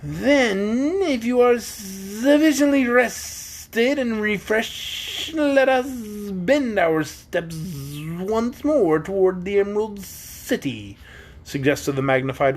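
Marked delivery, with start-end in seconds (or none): 0.0-11.0 high, slow, pompous bug; grandly slow; Wogglebug voice. A narrator's reading. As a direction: Wogglebug urging the party onward, slow and grand.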